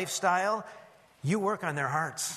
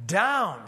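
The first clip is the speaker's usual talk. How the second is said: "down" punched louder with a falling tune; emphasis on "down"; 2 semitones higher, 5 dB louder than usual. Shown as a demonstration. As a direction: drop "down" heavily after a beat. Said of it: "I'll emphasize the key words".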